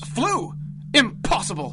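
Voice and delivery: high, incredulous burst; disbelief. Acted. Inventor hears the genie flew in, loud disbelief. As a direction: refuse to believe it.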